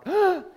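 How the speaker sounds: sharp, high gasp; mock shocked gasp. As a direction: gasp as if astonished. Retelling own shocked reaction.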